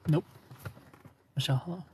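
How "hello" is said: ordinary talk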